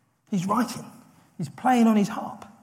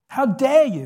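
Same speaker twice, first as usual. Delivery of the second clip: indignant, higher and louder; offended; 6 semitones higher, 5 dB louder than usual. Acted. David answering the giant's insult, offended. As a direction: throw it out as an affronted rebuke. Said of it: "David is offended".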